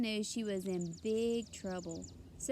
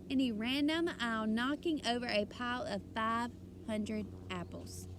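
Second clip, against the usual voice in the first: plain reading voice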